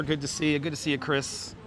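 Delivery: normal talking voice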